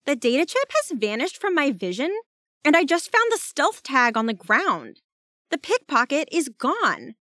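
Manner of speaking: high, urgent character voice; alarmed report. A narrator's reading. Jazz messaging that the pickpocket is gone, alarmed.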